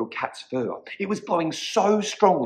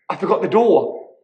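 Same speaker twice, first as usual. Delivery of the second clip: higher, louder exclamation; shocked little witch; 5 semitones higher, 7 dB louder than usual. A narrator's reading. Witch realizes she left out the door, loud dismay. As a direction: blurt it out, pitched up.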